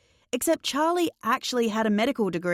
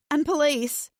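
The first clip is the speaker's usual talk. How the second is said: calling out; 7 semitones higher than usual. A narrator's reading. Calling for help after ambulance.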